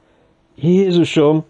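high, drawn-out cry of dread; terrified outburst. A narrator's reading. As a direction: let terror break out, stretching the word. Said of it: "tört ki a rémület Fergusonból". (rémült, elnyújtott felkiáltás)

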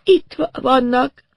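high, slow stammer; woman in shock. A narrator's reading. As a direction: stammer it out in a daze. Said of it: "rendes sokkot kapott odakint".

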